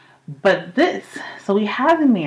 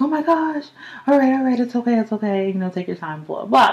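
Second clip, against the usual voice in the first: soothing mom; 7 semitones higher than usual. Retold or imitated. Mom calming her down.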